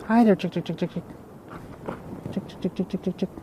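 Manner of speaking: high, soft chick-chick calls; calling chickens. Real, not acted. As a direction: coax the chickens over gently. Calling chickens, higher and softer.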